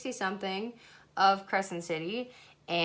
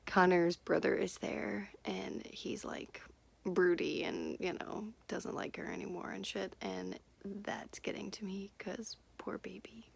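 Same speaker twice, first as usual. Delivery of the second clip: soft unvoiced whisper, much softer; hushed sympathy for poor Connor; 6 dB quieter than usual. Real, not acted. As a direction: whisper the gossip as if someone might hear. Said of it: "whispered gossip about book".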